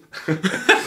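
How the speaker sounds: a short high laugh breaking out; burst of laughter. Real, not acted. Laughing at himself in front of friends, a loud burst. Laughs at his own story.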